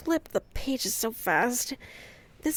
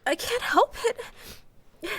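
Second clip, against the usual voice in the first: slow, high tearful plea; tearful, voice breaking; crying; 9 semitones higher, much slower than usual. Acted. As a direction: cry it out helplessly. Crying, can't stop.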